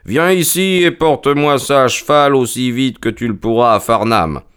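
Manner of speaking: ordre lancé d'une voix plus aiguë; Holmes autoritaire. Acted. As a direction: commander un valet avec assurance. Holmes commande le valet. (ton de commandement)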